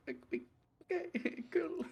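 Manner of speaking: soft awkward okay, rising high, laughing; awkward mock okay. To friends at dinner, a soft awkward okay. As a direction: laugh off an uncomfortable stare quietly. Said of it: "we're like".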